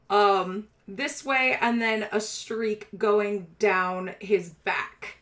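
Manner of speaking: slow, deliberate scene setting; game master description. A narrator's reading. Game master describing a gaudy rat boy, slow measured narration. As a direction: lay out each detail slowly, with pauses.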